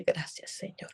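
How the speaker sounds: soft breathy whisper of gratitude; whispered thanks. Real, not acted. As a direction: breathe out a grateful prayer. Relieved prayer of thanks. (susurro de agradecimiento)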